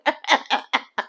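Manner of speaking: soft run of chuckles; real laugh. Real, not acted. Host laughing at the crying outside the club, amused. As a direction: chuckle helplessly before you can go on.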